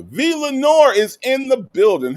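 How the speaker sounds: high, loud arrival announcement; hyped shout-out. Real, not acted. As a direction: announce the guest's entrance way up high, loudly.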